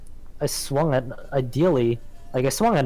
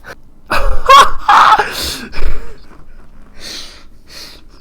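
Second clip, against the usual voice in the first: high, loud burst of laughter; 12 dB louder than usual. Real, not acted. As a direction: crack up hard. After killing the goblin.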